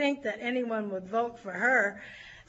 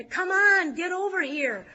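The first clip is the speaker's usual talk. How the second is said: calling across a crowded room, higher and louder; retold yell; 6 semitones higher, 6 dB louder than usual. Northern California delegates calling across the room, a loud yell. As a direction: yell it over everyone's heads. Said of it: "screaming over the heads".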